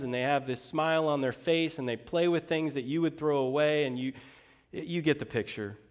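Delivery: ordinary talk, nothing marked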